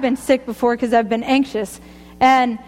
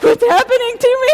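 retold panic, much higher and louder; panicked cry; 10 semitones higher, 4 dB louder than usual. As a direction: squeal it out in alarm, reliving the breakdown. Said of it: "this weird emotional breakdown".

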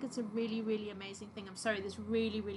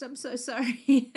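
laughing apology; 3 semitones higher, 10 dB louder than usual. Real, not acted.

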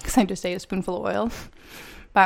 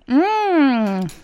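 savoring mmm; 5 semitones higher, 7 dB louder than usual. Real, not acted. Tasting chili sauce.

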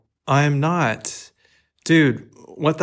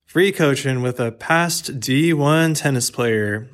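normal talking voice

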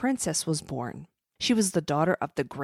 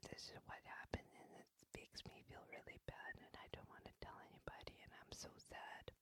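very soft, fast breathy whisper; 30 dB quieter than usual. Acted. Boy whispers his terrible problem into the princess's ear, fast whisper. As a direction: whisper the secret into her ear. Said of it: "the boy whispered the terrible problem".

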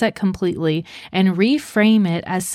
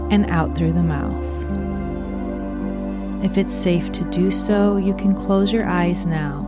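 guided meditation. Acted. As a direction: ease them gently into calm. Leads a creativity meditation.

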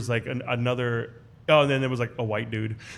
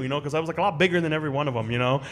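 ordinary delivery

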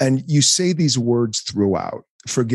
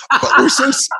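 laughing squeal; 12 semitones higher, 4 dB louder than usual. Real, not acted.

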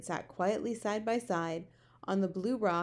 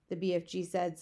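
ordinary delivery, nothing marked

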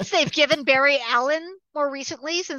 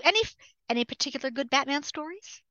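ordinary talk

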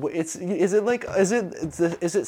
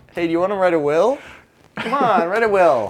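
loud, insistent nagging prompt; pushy screen voice; 5 semitones higher, 6 dB louder than usual. Acted. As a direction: nag them to write a will. Screen pushing passenger to write will.

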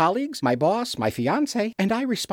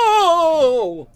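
theatrical anguish; 9 semitones higher, 4 dB louder than usual. Acted. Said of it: "one hand to my heart".

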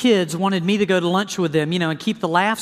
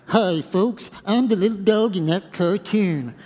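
cartoon dog impression; comic impression of a droopy dog. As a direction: put on a droopy cartoon hound voice. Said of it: "do Droopy, the, the dog from the cartoons".

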